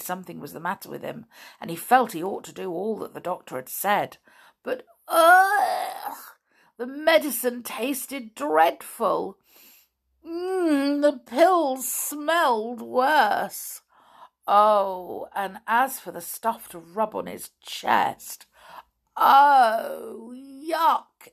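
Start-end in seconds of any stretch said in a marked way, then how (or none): 4.6-6.2 high, loud cry of disgust; disgusted ugh. A narrator's reading. Narrator recoiling at Binkle's medicine, disgusted ugh. 19.2-21.3 louder cry of disgust, then yuck. A narrator's reading. Narrator recoiling at the chest rub, yuck.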